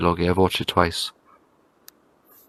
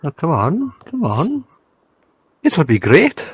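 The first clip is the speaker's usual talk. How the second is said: put-on Scottish accent; 8 semitones higher than usual. Goading a friend to do accents, in a Scottish accent. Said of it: "Was that your Scottish accent".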